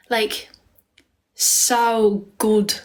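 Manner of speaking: drawn-out attempt at an Irish accent; trying on an Irish accent; imitated Irish accent. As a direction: copy the caller's Irish accent. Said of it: "my accent's perfect".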